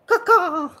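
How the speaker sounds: high, loud laughing crow caws; imitated crow caws through a laugh. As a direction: caw like a crow, half laughing. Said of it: "and he'll go".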